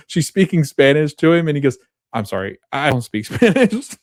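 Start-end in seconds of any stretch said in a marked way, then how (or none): 2.1-4.0 laughing through quote. Real, not acted. Breaks up laughing at retold line.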